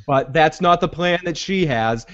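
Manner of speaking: usual speaking voice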